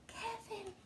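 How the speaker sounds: high, very soft whisper. Acted. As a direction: whisper his friend's name gently. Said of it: "Sid whispered".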